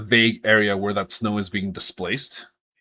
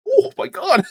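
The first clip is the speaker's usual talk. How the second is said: high, loud delighted exclamation; real, loud high-pitched astonishment; excited laughing outburst; 9 dB louder than usual. Real, not acted. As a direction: burst out laughing at a surprise. Reacting to a dice roll.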